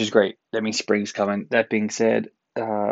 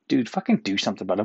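normal talking voice

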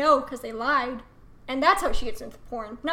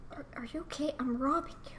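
whispered, puzzled; confused whisper; 10 dB quieter than usual. Retold or imitated. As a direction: hush it as a baffled robber. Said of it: "they'll be like".

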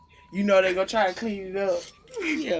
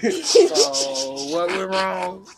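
slow cop voice; 4 dB louder, much slower than usual. Retold or imitated. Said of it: "the cop standing there like".

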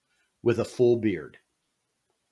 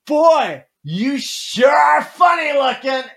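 high, loud, drawn-out holler; mimicking a boy's piercing yell; imitated child's shout; 10 dB louder, slower than usual. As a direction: blurt it out like a loud little kid. Kid blurting out at a stranger.

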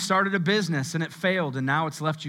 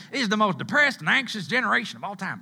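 put-on Southern accent; 5 semitones higher, faster than usual. Preacher mimicking critics of our generation, hammed-up Southern accent. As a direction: ham up the Southern twang as a mock complaint. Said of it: "I get a Southern accent when I talk like that".

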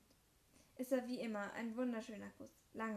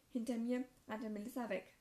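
usual speaking voice

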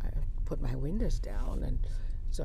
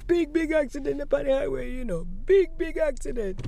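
replaying the Jamaican man, higher and louder; Jamaican accent; 12 semitones higher, 6 dB louder than usual. Recalling the highway man's words, Jamaican accent. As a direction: Jamaican accent with punch on big. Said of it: "this Jamaican accented gentleman".

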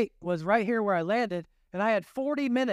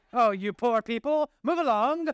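high, haughty brush-off; mock snooty; 4 semitones higher than usual. Preacher mocks a first class snob dismissing passengers, high and haughty. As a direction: lord it over the passengers, hammed up.

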